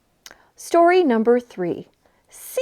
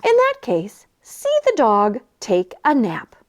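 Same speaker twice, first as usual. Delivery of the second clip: usual speaking voice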